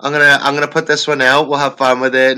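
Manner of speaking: loud, high carefree shrug; shrugging off. Retold or imitated. After the producer died, deciding breezily to release it. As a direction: brush it off breezily. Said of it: "So I was like".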